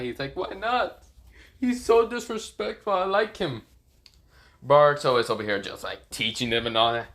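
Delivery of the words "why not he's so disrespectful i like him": high-pitched anime whine; mock tearful pleading. Sasuke begging Naruto to let him adopt Boruto, mock tearful. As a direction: plead in a high, hammed-up crybaby voice. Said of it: "crying tears and all that".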